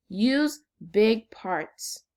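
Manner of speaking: each word spaced out and stressed; slow emphasis. Shown as a demonstration. Teacher slowly stressing the strategy to second graders.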